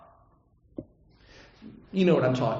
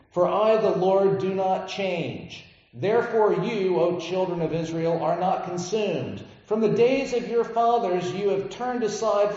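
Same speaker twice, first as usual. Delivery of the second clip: scripture reading; 5 semitones higher than usual. Acted. Preacher reading Malachi to the congregation, solemn proclamation. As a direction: declaim the verses with weight, slowing on key lines.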